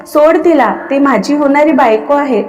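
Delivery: plain reading voice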